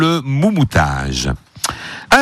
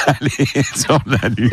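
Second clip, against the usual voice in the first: words squeezed out through laughter; laughing; 2 semitones lower, slower than usual. Real, not acted. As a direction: laugh while finishing the phrase. Host laughing at own joke. (en riant)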